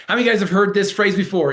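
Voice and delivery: normal talking voice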